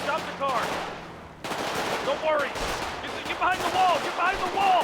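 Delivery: high, loud panicked orders; panicked yelling. Acted. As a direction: shout orders while pinned down. Under fire, yelling orders.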